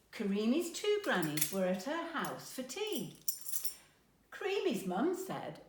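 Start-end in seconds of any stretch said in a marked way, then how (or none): none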